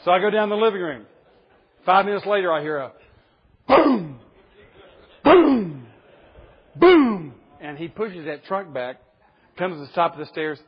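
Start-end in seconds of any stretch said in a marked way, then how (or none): 3.7-7.3 loud booming thuds, each falling; imitated banging. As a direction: bang out each boom like a door being hit. Child banging against the trunk.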